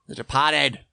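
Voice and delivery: put-on The Departed said in a Boston accent. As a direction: throw on a Boston accent for the title. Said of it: "It's my Boston accent".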